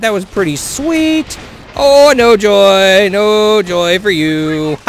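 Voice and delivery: high, slow, drawn-out singsong gloat; gloating taunt. Real, not acted. Player gloats at an enemy he just shot, slow mocking taunt. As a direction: savour every word at the beaten enemy.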